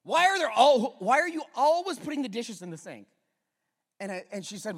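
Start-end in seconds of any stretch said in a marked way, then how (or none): 0.0-3.1 irritated wife. Retold or imitated. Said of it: "a little irritated".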